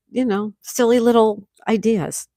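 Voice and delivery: normal talking voice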